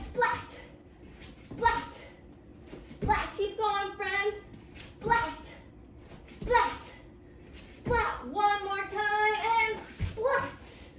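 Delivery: lightning splat. Shown as a demonstration. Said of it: "you go splizzat".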